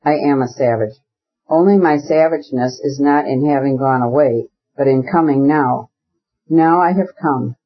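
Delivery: usual speaking voice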